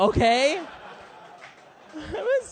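ordinary talk, nothing marked

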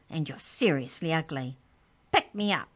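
high squeaky impatient creature voice; a tiny sprite talking down to a boy. Acted. The book elf answers Trent, high and squeaky. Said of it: "The book's voice was high and squeaky".

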